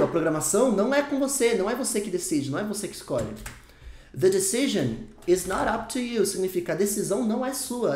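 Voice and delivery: usual speaking voice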